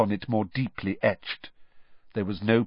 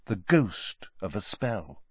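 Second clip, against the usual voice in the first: plain reading voice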